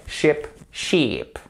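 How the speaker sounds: first "Sheep" short as ship, second long; brief lax i, then drawn-out ee in "Sheep, sheep"; short versus long. Shown as a demonstration. Teacher contrasts vowels, "Sheep" short then long. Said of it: "avec le bas pour les voyelles longues".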